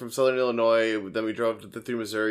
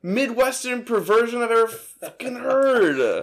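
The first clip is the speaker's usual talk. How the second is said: exaggerated outrage; 12 semitones higher, 4 dB louder, slower than usual. Real, not acted. Mock outrage over a pronunciation.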